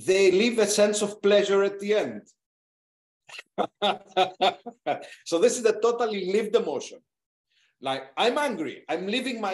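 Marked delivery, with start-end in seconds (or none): 3.3-5.2 hearty run of laughter; higher, rolling laugh; real laugh. Real, not acted. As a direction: laugh out in a long chuckle. Laughs after the point.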